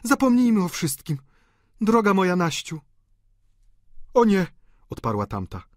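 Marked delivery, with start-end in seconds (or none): 0.0-2.8 high, loud emotional woman; effusive high female voice; reconciling sister. A narrator's reading. Delphine embraces sister to reconcile. 4.1-4.5 high, loud refusal; emphatic countess. A narrator's reading. Countess answers her sister.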